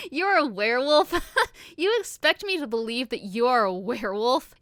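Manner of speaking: incredulous, laughing through the words; laughing disbelief. A narrator's reading. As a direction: laugh at an absurd claim. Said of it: "I began to laugh".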